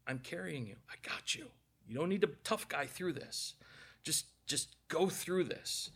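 lightly voiced tender Lord, half whispered; gentle reassurance. Lord reassures sick preacher on stretcher, soft and tender.

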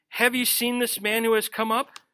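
ordinary delivery

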